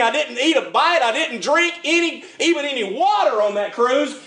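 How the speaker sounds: ordinary talk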